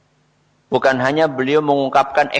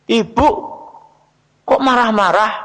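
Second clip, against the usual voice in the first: put-on child's protest; 7 semitones higher than usual. Child asks mother why she is angry, high-pitched protest. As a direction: voice a child taken aback by mum's tone. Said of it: "anak pahamnya nopo? Dimarahi".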